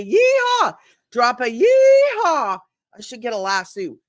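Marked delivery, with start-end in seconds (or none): 0.0-0.7 high, loud cowboy whoop; yeehaw call. Shown as a demonstration. Host demonstrating her call to action for viewers, whooping. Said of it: "I say". 1.1-2.6 higher, louder and slow; drawn out with a grin; excited invitation. Shown as a demonstration. Host urging viewers to comment, slow and excited. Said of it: "I say".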